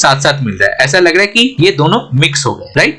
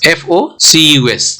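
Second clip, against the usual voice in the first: slow spelling; slower than usual. Shown as a demonstration. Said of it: "spelling out letters".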